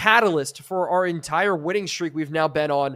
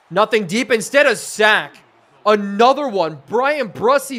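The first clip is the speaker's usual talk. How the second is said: raised, louder, punchy play call; excited sack call; 4 semitones higher, 5 dB louder, slower than usual. Real, not acted. Streamer calling another sack live, excited. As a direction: celebrate the sack as it happens.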